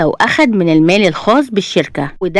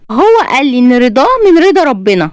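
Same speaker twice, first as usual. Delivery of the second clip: defensive bride; 7 semitones higher, 3 dB louder than usual. A narrator's reading. Bride protests to scolding friends.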